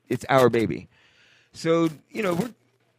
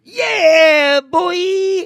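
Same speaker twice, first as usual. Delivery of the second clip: alley cat; 6 dB louder than usual. Acted. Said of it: "I sound like an alley cat".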